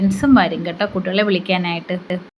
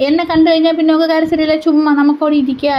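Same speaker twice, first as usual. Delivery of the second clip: lightly voiced small child, higher; a mother's playful take on her child's logic, pitched up; imitating her little daughter; 7 semitones higher than usual. Mother voicing her daughter's fake crying at school, in a mock childlike high voice.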